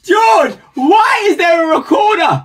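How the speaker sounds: shocked shout. Real, not acted. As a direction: yell it in shock. Shocked at George's recorder.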